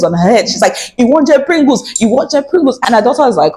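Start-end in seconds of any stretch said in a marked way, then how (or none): 1.0-2.8 scolding mother. Retold or imitated. Mother hitting daughter, retold.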